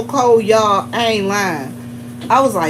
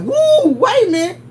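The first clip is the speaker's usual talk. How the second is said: startled woo; 8 semitones higher than usual. Retold or imitated. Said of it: "be like".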